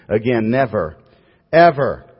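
preacher slamming the word Ever; emphatic never ever. Real, not acted. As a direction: hammer home never, then ever. Insists believers never fear judgment.

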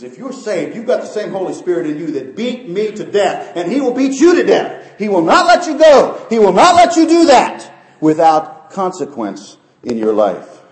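high, loud pulpit warning, building to a shout; full-force preaching. Real, not acted. Preacher warning the saved that God chastens, full-force preaching.

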